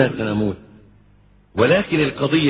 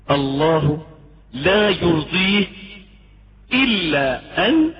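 normal talking voice

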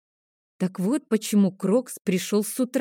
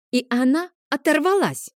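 put-on high boyish outburst; sudden high cry of dismay; regretful exclamation; 9 semitones higher, 4 dB louder than usual. A narrator's reading. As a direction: exclaim in a high child's voice, sorry it happened. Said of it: "воскликнул Тео".

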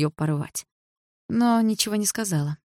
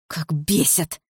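mild hiss; much slower than usual. A narrator's reading. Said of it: "процедила сквозь зубы Дилара".

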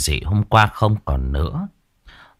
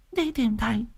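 much higher, sharp scolding wife voice; nagging old wife; 4 dB quieter, faster than usual. A narrator's reading. Wife scolds husband for his fright, higher nagging voice. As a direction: fuss and scold like a village wife.

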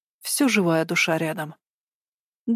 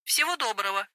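operator voice; 3 semitones higher, 7 dB quieter than usual. Acted. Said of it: "проговорила девушка".

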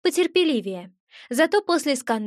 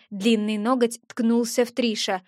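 usual speaking voice

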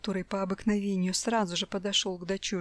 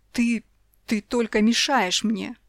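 louder, slow biting words; husband lashing out; angry outburst; 7 dB louder, slower than usual. A narrator's reading. Husband yells at wife. (муж зло кричит на жену)

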